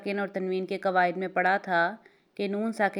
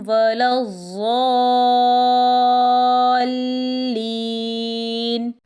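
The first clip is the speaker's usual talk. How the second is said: very slow, melodic tilawat, louder than the explanation; Quran recitation; 4 semitones higher, 7 dB louder, much slower than usual. Shown as a demonstration. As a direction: chant the verse slowly, holding the long vowels. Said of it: "اگلی آیت ہے". (آہستہ ترتیل کے ساتھ تلاوت)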